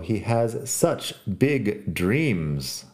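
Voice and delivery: slow, word by word; very slow dictation. Shown as a demonstration. Teacher to Spanish learners, dictating very slowly.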